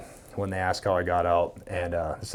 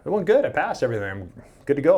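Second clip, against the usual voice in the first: upbeat report; 7 semitones higher, 5 dB louder, faster than usual. Retold or imitated. Reports passing the test.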